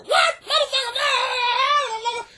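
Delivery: high, loud shrieking no no no; shrieking protest. Pretend. Housewife screams back at the TV shrink, shrieking. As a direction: shriek it in outraged disbelief.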